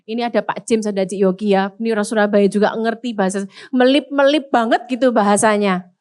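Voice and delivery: normal talking voice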